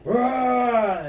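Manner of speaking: high, loud order to charge; battle cry. Retold or imitated. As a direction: yell it as the men break into a run.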